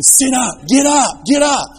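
bossy big sister yelling; escalating shout. Retold or imitated. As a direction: yell it at a sister hanging off the pew. Sister grabbing at her, escalating.

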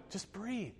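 imitating a birth coach's soft urging; retold coaching. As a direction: coax a partner to breathe, gently. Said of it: "And I'm going".

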